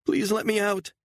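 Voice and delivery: high, begging woman's voice; pleading mother. A narrator's reading. Mother begging her captor to let her go, high and pleading. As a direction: plead for your daughter's sake.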